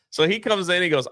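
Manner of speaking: ordinary talk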